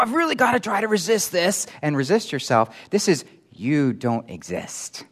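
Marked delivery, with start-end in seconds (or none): 0.0-1.7 high-pitched put-on strain; mimicked struggler. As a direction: act out someone struggling with temptation. Mimics someone straining to resist.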